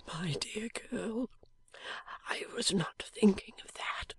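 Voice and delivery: slow, breathless whisper of a wounded man; dying man's whisper. A narrator's reading. Wounded husband whispering to his wife, faint and slow. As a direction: barely get the words out, fading.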